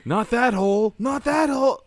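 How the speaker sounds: high, squealed protest; mock alarm. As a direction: yelp it in pretend distress. Mock alarm at being plugged in.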